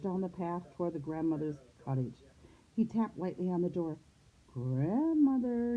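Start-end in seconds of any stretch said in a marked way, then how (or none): 4.5-5.8 put-on high, drawn-out little-girl impersonation; wolf imitating a little girl, higher and slow; little girl's voice. A narrator's reading. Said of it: "in his best little girl's voice".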